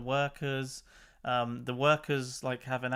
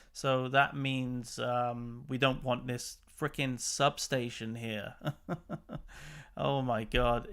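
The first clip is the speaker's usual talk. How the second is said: ordinary delivery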